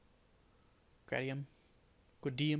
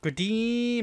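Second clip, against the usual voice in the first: Gradim sung out high, loud and long with a laugh in it; playful high stretched-out Gradim; high drawn-out Gradim; 10 semitones higher, 7 dB louder than usual. Shown as a demonstration. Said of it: "You gotta say it like that every time".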